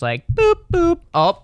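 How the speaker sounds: high, bright button boops; chirpy high boop noises; boop sound. Retold or imitated. Imitating tapping buttons.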